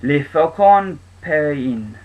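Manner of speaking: "Le Falcon Peregrine" in a slow, careful French accent; hesitant amateur French accent, said slowly; French accent attempt. Shown as a demonstration. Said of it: "I don't know if that was a good French accent".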